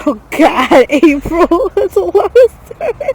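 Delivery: loud, high shocked laugh. Real, not acted. Cinnamon spoonful, friend reacts.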